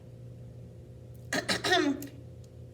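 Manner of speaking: small, high throat-clearing; attention-getting cough. Acted. A cricket interrupts Gerald, coughing politely. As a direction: clear your throat to get noticed. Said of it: "coughed a cricket".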